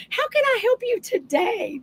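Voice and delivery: high, sweet offer of help; sweet helpful customer. Retold or imitated. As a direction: sound eager to help. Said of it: "Ask her".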